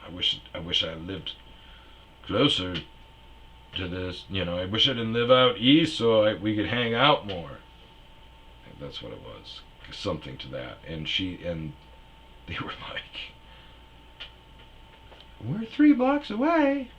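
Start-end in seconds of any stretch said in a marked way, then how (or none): 0.0-7.6 smooth confident line, a bit higher; lightly voiced smooth talker. Comic replaying his smooth line to the girl. 15.4-16.9 put-on very high, loud falsetto girl; high girly voice. Comic voicing the concert girl, very high falsetto. Girls quoted.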